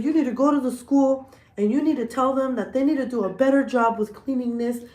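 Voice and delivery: hammed-up fatherly ranting; mock rant. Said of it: "he went on a rant".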